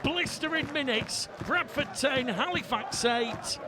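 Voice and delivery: loud, high-pitched live rugby commentary; excited try call. Real, not acted. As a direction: belt out the try as it happens, voice lifted high.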